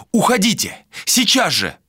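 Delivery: high, urgent command to leave; angry demand. Acted. Malika ordering Vilar out of her room, angry demand.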